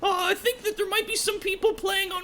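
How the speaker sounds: high goblin voice, friendly and eager; a helpful little goblin showing the way; high squeaky goblin explaining; chatty goblin. Acted. GM holds goblin voice.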